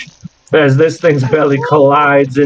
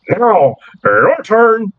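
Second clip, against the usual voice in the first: slow, higher creature voice; creature's challenge; 4 semitones higher, much slower than usual. Acted. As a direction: draw it out as a slow invitation to strike. Game master voices creature's challenge.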